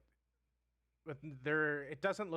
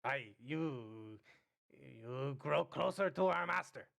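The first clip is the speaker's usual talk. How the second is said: goblin voice. Acted.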